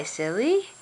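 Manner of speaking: ordinary delivery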